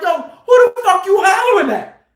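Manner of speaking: high, combative shout back; retold heated comeback; matching his hollering. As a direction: challenge him at a high holler. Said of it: "I'm matching his energy".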